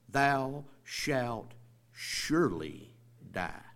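THOU SHALT SURELY DIE, each word slow and set apart; weighty, spaced-out "thou shalt surely die"; emphatic scripture reading. Shown as a demonstration. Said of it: "That's a little more emphatic".

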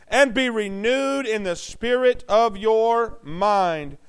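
each word of "and be renewed in the spirit of your mind" drawn out slowly; solemn slow scripture; slow reading. Shown as a demonstration. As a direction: read the verse word by word.

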